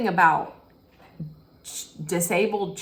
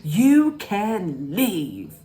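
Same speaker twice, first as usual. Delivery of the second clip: slow, drawn-out mock scream; hammed-up retold outburst; imitated scream; 3 dB louder, much slower than usual. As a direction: drag each word out dramatically. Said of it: "Like that, when she says".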